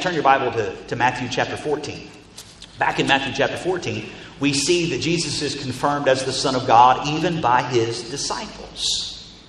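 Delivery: ordinary talk, nothing marked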